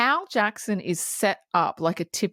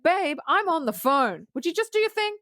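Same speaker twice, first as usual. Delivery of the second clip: high-pitched impatient snap at the customers; imitating Whoopi brushing off the ladies; mock yell; 7 semitones higher than usual. Shopkeeper on the phone snapping at two customers, mock yell. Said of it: "she starts yelling at them".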